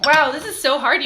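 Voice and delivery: laughing, struggling to compose; trying not to laugh. Real, not acted.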